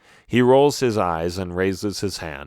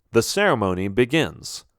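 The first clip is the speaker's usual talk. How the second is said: ordinary delivery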